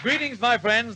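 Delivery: normal talking voice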